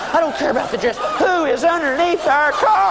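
high acted demand, slowing on the question; frantic demand. Wife demanding to know who is under the car, frantic. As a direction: climb and stretch out the question.

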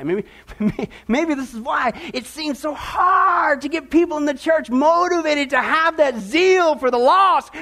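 slow, choked-up pleading, voice rising; tearful plea. Real, not acted. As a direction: let the tears come through the words, slowly. Preacher overcome while pleading.

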